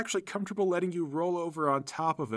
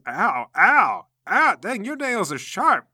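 high, loud cries of pain; drawn-out mock yelp at a sting; 3 semitones higher, 6 dB louder, slower than usual. Game master voices the clawed fighter, pained yelp. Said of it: "and he goes".

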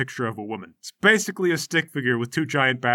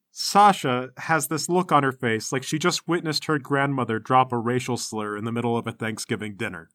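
ordinary delivery

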